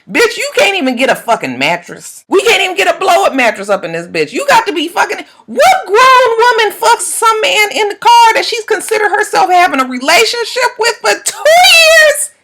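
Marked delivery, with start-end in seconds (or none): 4.3-12.3 outraged shout. Real, not acted. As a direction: scream your disbelief at the screen. Outraged by the show contestant.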